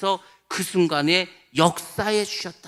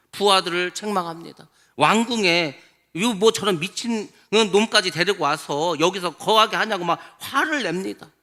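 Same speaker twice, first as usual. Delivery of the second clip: ordinary delivery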